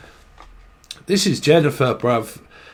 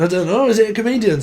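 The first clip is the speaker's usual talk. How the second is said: ordinary delivery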